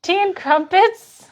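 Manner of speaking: high, loud, slow put-on accent; hammed-up tea-party voice; a silly fake British accent; mock British. Keeps the silly accent bit.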